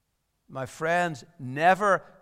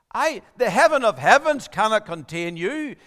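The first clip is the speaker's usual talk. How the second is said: ringing out the praise with louder voice; exalted proclamation; 6 semitones higher, 9 dB louder than usual. Acted. Solomon praying aloud before the temple, exalted and loud. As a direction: lift it high and loud like a king praying.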